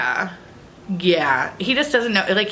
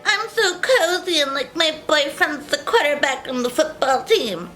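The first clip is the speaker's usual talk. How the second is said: exaggerated basic-girl squeal; 8 semitones higher than usual.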